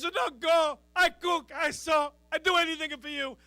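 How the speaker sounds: high, loud hammed-up begging, Italian accent; mock crying mother. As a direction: wail it like a desperate Italian mama. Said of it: "She's crying".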